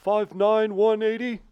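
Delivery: high loud old-man impression; shouted Bernie weigh-in. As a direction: belt it like cranky Bernie.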